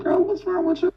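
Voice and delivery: high-pitched, retold kid's question; puzzled questioning. Kids asking their cousin at the window, puzzled.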